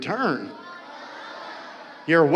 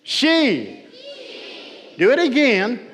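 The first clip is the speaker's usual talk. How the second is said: projected call-and-response; 9 semitones higher, slower than usual. Shown as a demonstration. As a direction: call the word out to the crowd. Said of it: "Everybody repeat after me and do what I do".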